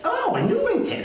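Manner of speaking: high, surprised oh of recognition; light up at a familiar town name. Real, not acted. Host recognizing the listener's hometown, pitched high in surprise.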